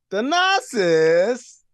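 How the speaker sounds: slow, high, loud rising name; drawn-out name reveal. Real, not acted.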